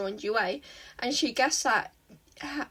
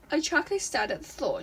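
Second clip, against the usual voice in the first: ordinary delivery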